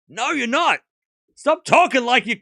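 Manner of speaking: mock-exasperated outburst at the player. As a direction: push back hard, half yelling.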